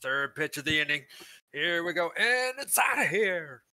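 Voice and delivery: rising excited play-by-play call; baseball announcer. Pretend. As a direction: call it like a home run. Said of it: "we're on the hooks going".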